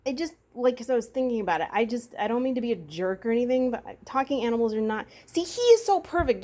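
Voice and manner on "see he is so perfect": cooing at pet. Real, not acted.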